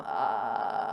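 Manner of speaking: low creaky croak on ah; vocal fry croak. Shown as a demonstration. Coach demos a croaky vocal fry at the bottom. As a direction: let it crackle down into fry. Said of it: "have a little croak at the bottom".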